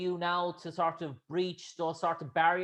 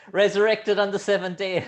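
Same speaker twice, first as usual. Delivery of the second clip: chuckling through the words; laughing joke; 3 semitones higher, 8 dB louder than usual. Real, not acted. Host jokes to the actress, laughing. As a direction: laugh while you land the punchline.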